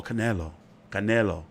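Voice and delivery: "Canelo" said softly, the Spanish way; Spanish pronunciation. Shown as a demonstration. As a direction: carefully try "Canelo" with Spanish vowels, low and soft. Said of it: "learn the, the correct pronunciation".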